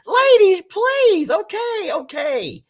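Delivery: high excited squeals; giddy pleading. Acted. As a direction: beg them off in a squeaky giddy voice.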